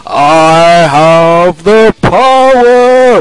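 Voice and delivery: loud, slow, high heroic cry; booming hammed-up He-Man shout; He-Man battle cry. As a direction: hold each word like a hero transforming. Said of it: "one of the greatest sayings".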